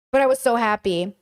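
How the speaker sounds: ordinary talk, nothing marked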